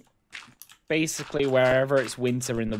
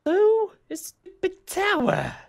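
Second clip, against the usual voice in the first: very high, drawn-out squeak; silly high voice; 4 dB louder, slower than usual. Pretend. Teasing co-builder about the storage building, silly high voice. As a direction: squeal it slowly in a daft high voice.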